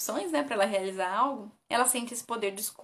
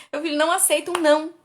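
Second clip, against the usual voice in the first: higher, louder exasperated parent; quoting a worried parent; 6 semitones higher, 9 dB louder than usual. Retold or imitated. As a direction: plead like a frustrated parent. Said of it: "me perguntam assim". (pai aflito, voz mais alta e forte)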